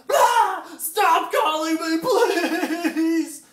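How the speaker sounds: high, loud, drawn-out scream at the caller; desperate shout. Retold or imitated. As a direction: yell it down the phone, at the end of your rope. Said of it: "I answered the phone and I went".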